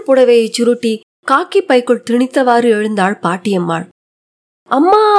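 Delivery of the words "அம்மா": high, rising cry to mother; tearful cry. A narrator's reading. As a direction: call out pleadingly to your mother. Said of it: "தாரை தாரையாய் கண்ணீர் வடித்தார்". (கெஞ்சலாக உயர்ந்த குரலில் அழைப்பு)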